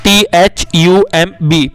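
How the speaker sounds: T H U M B spelled slowly; drawn-out letter by letter; spelling slowly. Shown as a demonstration. Teacher spelling thumb slowly for children. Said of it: "अंगूठे की English यहाँ पर".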